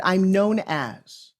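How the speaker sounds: I'm known as, spoken very slowly, each word weighted; slow and deliberate. Shown as a demonstration. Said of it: "I told you to say it like this".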